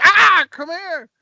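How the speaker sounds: loud, high, drawn-out bad celebrity impression; Tom Hanks. Comic mimicking an actor for laughs, bad impression. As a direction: yelp it as a badly faked actor impression. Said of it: "can't do a good Tom Hanks impression".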